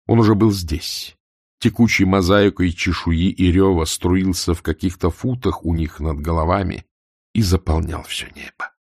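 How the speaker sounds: usual speaking voice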